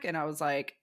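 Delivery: usual speaking voice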